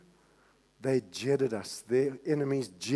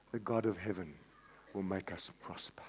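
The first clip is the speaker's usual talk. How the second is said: hushed confidence; 7 semitones lower, 10 dB quieter than usual. Acted. Nehemiah's quiet reply.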